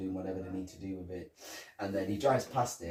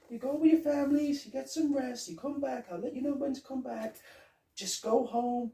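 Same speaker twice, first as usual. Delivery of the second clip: high, fast put-on official voice; mock inspector barking orders; bossy inspector. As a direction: order the workers around in a squeaky fake authority. Imitating Foley sending workers home.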